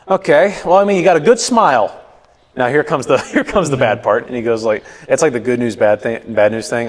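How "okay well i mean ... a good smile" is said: higher-pitched dentist giving faint praise; lightly put-on dentist voice; imitated dentist.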